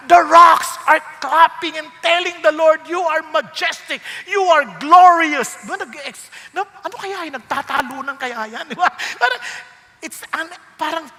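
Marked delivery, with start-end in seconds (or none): 0.1-5.6 loud, soaring and joyful; excited praise. Pretend. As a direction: proclaim it like creation shouting praise. Imagining creation praising God.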